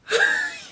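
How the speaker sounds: a squealing high laugh. Real, not acted. Laughing at her own story of panic. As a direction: burst into a shrill giggle.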